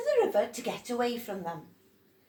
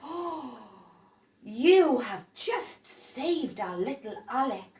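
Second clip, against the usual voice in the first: louder praising adult voice; grateful grown-up; 5 semitones higher, 7 dB louder than usual. Acted. Adult praising Eduardo for saving Alec, loud. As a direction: thank him for saving Alec.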